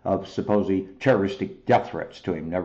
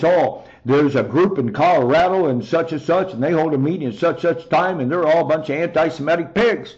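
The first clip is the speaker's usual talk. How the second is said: mocking media voice; 5 semitones higher, 4 dB louder than usual. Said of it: "writing stories".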